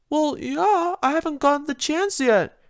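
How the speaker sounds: a touch of irritation up high; annoyed Rarity. Acted. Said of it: "Rarity said in annoyance".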